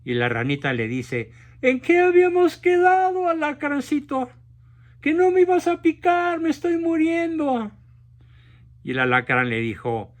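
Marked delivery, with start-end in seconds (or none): 1.6-7.7 much higher, louder wailing frog voice; dying frog. Acted. Stung frog wailing at the scorpion, high and loud. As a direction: cry out high in betrayed pain.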